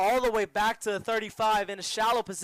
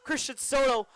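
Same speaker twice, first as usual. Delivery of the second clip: higher-pitched, excited sports call; live play-by-play; 5 semitones higher than usual. Real, not acted. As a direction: call the run as it unfolds.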